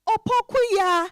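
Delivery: put-on high, gushing joy at seeing an old friend; excited reunion. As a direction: squeal with delight at meeting her. Friends reunite after weeks.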